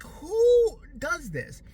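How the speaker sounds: drawn-out disbelieving cry; slow, loud mock outrage; exasperated. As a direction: stretch it out in outrage. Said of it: "I'm like".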